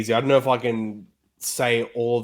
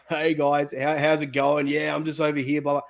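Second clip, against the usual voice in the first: higher-pitched casual Aussie greeting; imitated Aussie accent; 5 semitones higher than usual. Guest imitating an American's TV interview, easygoing Aussie accent. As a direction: mimic an American nailing the Australian accent. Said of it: "he sounds exactly like".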